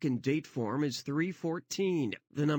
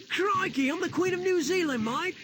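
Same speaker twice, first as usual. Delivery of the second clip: put-on Australian accent; 13 semitones higher, 4 dB louder than usual. Queen voiced Aussie-style, very high.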